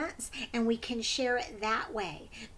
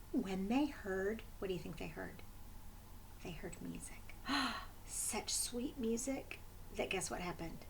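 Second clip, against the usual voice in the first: much softer, whispered; storyteller's hush; hushed suspense; 4 semitones lower, 11 dB quieter than usual. A narrator's reading. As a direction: lean in and whisper the secret. Suspense for children.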